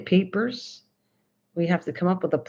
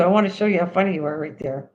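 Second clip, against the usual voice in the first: ordinary delivery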